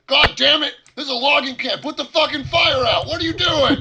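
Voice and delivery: high, very loud outraged shouting; furious hollering. Acted. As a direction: holler at the top of your voice like an irate camp boss. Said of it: "and then start hollering".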